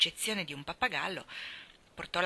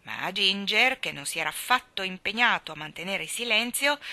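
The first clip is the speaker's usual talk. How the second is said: ordinary delivery, nothing marked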